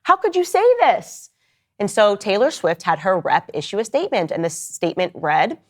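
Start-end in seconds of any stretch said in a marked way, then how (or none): 0.0-1.3 high squealing distressed fan; mock fan squeal. Swifties mocked in a squealing high voice. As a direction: squeal it like a betrayed superfan. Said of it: "The Swifties were like".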